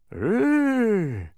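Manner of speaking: high, loud acted gasp. A narrator's reading. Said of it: "gasped the bug".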